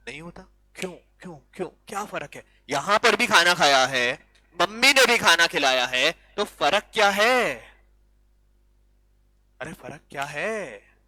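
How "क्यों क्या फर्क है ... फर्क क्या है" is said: usual speaking voice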